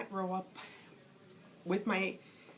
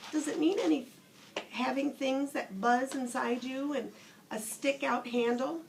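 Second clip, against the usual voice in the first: higher, timid little rabbit asking; a small toy wondering aloud; curious toy rabbit; 5 semitones higher than usual. Acted. The rabbit questioning the Skin Horse, higher and curious.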